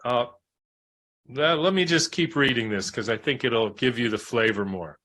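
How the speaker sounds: ordinary delivery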